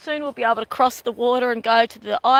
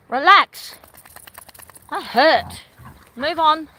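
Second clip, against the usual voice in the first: louder, firm words to the dog; firm scolding; 5 semitones higher, 5 dB louder, slower than usual. Real, not acted. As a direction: settle the dog down firmly. Scolding the dog after bite.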